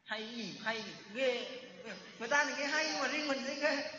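sneering, dismissive mutter of an envious listener; mock the good voice with a sneer; half-hearted imitation of a jealous scoffer. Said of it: "nói sao?".

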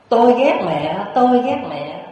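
put-on high, loud angry child's cry; yelling out. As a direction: yell it out into the forest. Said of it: "cậu ta mới la lớn lên".

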